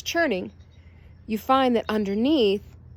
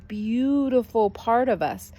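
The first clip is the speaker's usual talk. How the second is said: ordinary delivery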